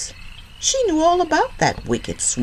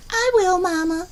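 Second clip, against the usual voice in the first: put-on little girl voice. Liza Lou answers her mother.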